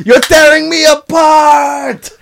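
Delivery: high, loud, drawn-out melodramatic scream; Tommy Wiseau. Acted. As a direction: overact an anguished shout, stretched out slowly. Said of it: "Then the father-in-law screamed".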